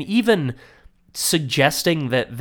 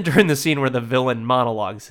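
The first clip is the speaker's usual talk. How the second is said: normal talking voice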